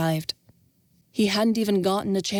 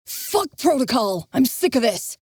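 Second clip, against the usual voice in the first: angry outburst; 5 semitones higher than usual. Acted. Devon explodes and leaves.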